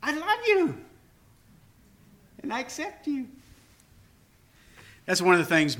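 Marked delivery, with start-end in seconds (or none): 0.0-3.3 tender words to Hazel. Acted. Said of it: "I can say this".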